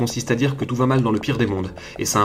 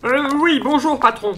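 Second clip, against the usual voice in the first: very high, nervous nerd; timid high-pitched techie; nerdy squeaky voice; 3 dB louder than usual. Acted. Nerdy character voice.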